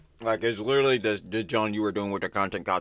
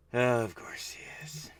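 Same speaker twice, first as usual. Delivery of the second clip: whispering; 5 dB quieter, slower than usual. Real, not acted. Aside whisper.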